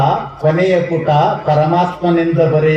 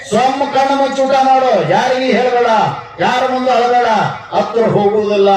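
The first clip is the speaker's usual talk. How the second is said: higher, fervent preaching that drives each line home; preaching at full force; 7 semitones higher than usual. Acted. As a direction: exhort the crowd like a revival preacher. Preacher raises voice to exhort.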